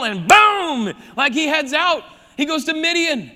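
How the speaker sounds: loud, punchy burst; excited. Real, not acted. Dramatic escape in the retelling.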